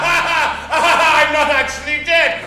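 loud, slow, high villain cackle; evil laughing. Acted. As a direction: gloat over the twist. Vampire revealed alive in jar.

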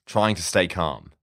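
ordinary delivery, nothing marked

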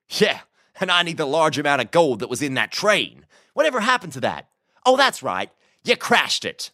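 high-pitched, needling American voice; sarcastic American cowboy. A narrator's reading. Prisoner reminds Boone of the lost gold, sarcastic. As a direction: rub his failure in with sarcasm.

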